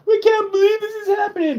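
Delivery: high, whiny mock panic voice; mocking idiots. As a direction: squeal it like a clueless fool. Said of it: "all these idiots who are like".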